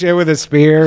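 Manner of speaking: ordinary delivery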